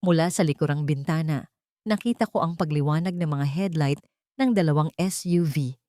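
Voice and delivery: plain reading voice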